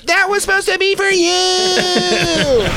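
stretched slow high falsetto, laughing; high giggly falsetto tease; mocking high squeaky voice. Mocking the girl's line in a slow high falsetto. Continues high girly bit.

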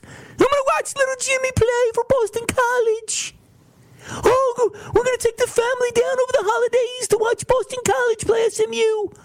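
high, excited, hammed-up parent voice; mock proud parent. Imitating fans' parents planning holiday trips, high hammed-up gushing. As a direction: gush like a proud mom planning the trip. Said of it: "They care about these".

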